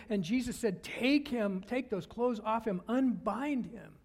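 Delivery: loud, high-pitched sermon climax; impassioned preaching. Real, not acted. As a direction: preach the suspense at full force, driving up to the command.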